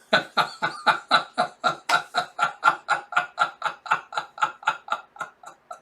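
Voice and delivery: long breathy laughing fit; helpless laughter. Real, not acted. Reader cracking up at the manga's punchline, real laughter.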